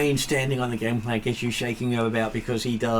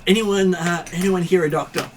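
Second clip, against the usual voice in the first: urgent call; 7 semitones higher, 4 dB louder than usual. Acted. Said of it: "He says".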